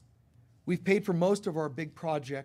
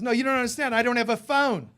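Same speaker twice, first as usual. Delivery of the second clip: put-on emphatic Australian accent, much higher and louder; an Aussie insisting he got a call without a phone; Australian accent, pushing his point hard; strong Australian accent; 8 semitones higher, 10 dB louder than usual. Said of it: "in his strong accent".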